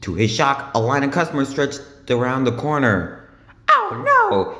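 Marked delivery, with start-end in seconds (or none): 3.7-4.5 high, loud panicked cry; alarmed cry. A narrator's reading. SpongeBob sees the line of customers, crying out high and loud. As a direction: yelp it out in alarm. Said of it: "he cried".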